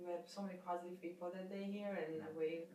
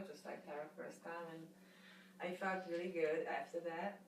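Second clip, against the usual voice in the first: ordinary talk, nothing marked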